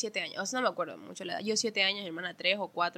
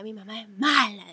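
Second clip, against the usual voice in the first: put-on louder childish whine about mom; childish complaint; 8 dB louder than usual. Kid complaining about her mom, louder and sulky. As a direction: sulk it out like a kid. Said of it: "Yo a veces decía".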